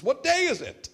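put-on high, excited old Scrooge calling out. Scrooge calls from his window to the boy, eager and high. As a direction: call down to the boy in the street. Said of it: "Scrooge busts out the window".

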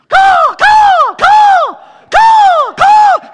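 loud, high-pitched crow caws; piercing, repeated mock bird cries; raven squawk. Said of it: "squawk like a raven or crow".

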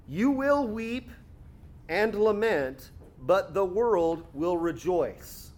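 slow, weighty scripture; slow, deliberate reading of Jesus' words; slow solemn reading. Acted. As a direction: draw out each phrase gravely.

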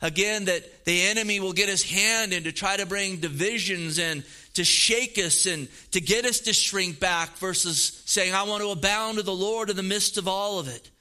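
normal talking voice

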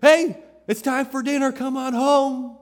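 loud, high call down the street. Retold or imitated. Parent calling kids home from down the street, loud. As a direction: shout for the kids to come home. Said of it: "we shout when our kids are down the street".

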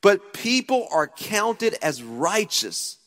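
slow, preached reading of the verse; scripture reading. Acted. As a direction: draw out the verse.

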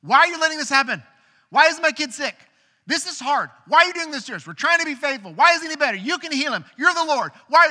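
high, loud and fast angry pleading; frustrated father venting at God over his sick son; furious inner rant. Retold or imitated. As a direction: retell raging at God, almost shouting. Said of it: "really mad at the Lord".

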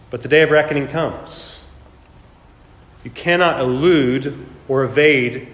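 ordinary talk

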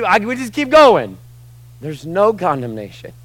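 high, loud, fervent preaching; preaching at full force. Acted. Preacher shouting no condemnation to the church. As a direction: lift the room with every word.

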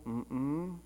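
put-on soft closed-mouth denial from a guilty child; a small, quiet fib to a parent; hummed no, playing the guilty kid. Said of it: "he has the guilty face".

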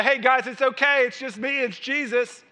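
high, chirpy offhand reassurance; mock casual greeting. Preacher imagines Jesus greeting disciples, mock casual and high. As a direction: toss it off as a too-casual hello.

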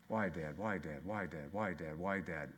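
low, soft, repeated mock whine; understated imitation of a child asking why; a hint of a nagging child. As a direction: lightly mimic a pestering kid. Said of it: "he came to me with questions".

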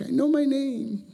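very high, drawn-out whine; mock little-kid protest, squeaked slowly; retold childish protest. Third grader telling the teacher he knows his name, high mock whine.

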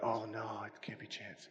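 low, hushed disbelief; very soft, low aside; doubting family. Acted. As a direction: murmur it softly, not daring to believe. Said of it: "they're like".

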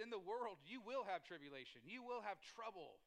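quoted scripture rung out high and loud, WILL hammered; loud preaching. Acted. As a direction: proclaim the verse to the church, slowing on the last line.

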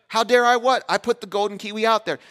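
high, loud incredulous reply; dad startled by his son's accusation; baffled protest. Retold or imitated. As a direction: sputter back in disbelief.

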